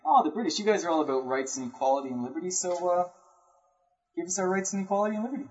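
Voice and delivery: mock-innocent plea, speeding up; Gandhi to the British. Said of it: "He's like".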